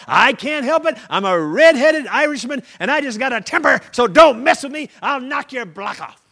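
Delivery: loud, high hot-tempered bluster; blustering redhead. Acted. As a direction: threaten like a hothead. Redheaded Irishman voiced.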